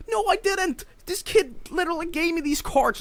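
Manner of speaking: high, pleading protest; acted-out indignant kid; protesting innocence. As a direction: plead your case to mom. Acted protest to angry mother.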